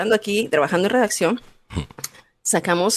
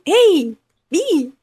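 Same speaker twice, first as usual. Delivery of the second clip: retold shout; 9 semitones higher than usual. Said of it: "y le grita".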